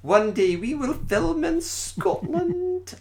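slow, high, hammed-up Scottish accent. Shown as a demonstration. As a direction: stretch it out in a broad Scottish accent. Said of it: "my Scottish accent's very, very good".

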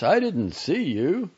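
high, loud, drawn-out words; surprised apology. Acted. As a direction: stretch it out in mild surprise. Doctor suddenly notices the waiting father.

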